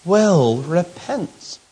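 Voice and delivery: "Will repent" slow, each word weighted; "Will repent" drawn out, rising; stress on will repent. Shown as a demonstration. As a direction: spell out "Will repent" slowly. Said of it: "what he says at the end".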